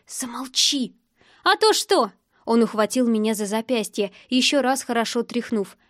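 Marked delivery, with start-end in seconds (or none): 0.1-0.9 fierce, voiceless whisper; whispered command. Acted. Sister ordering the man to stop, fierce whisper. Sister silencing him in a quarrel. 1.4-2.1 high, mocking dare; defiant challenge. Man about to grab her wrist, defiant.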